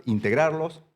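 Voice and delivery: usual speaking voice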